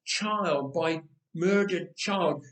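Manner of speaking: plain reading voice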